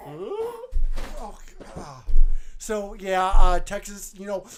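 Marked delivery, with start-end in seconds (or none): 0.1-1.4 high cry. Real, not acted. Reacting to back scratcher.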